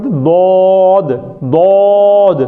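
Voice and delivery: دود stretched very slowly, half sung; slow chanted دود; drawn-out demo. Shown as a demonstration. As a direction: hold دود long and sing it out. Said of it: "دواد بھی نہیں پڑھتے".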